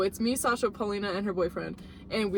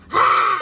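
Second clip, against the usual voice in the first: loud high startled yelp; mock hiss scream; 11 semitones higher, 11 dB louder than usual. Imitating the kid in the headlights, loud yelp. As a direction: let out a sudden loud yelp. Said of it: "He's like".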